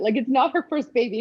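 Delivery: ordinary talk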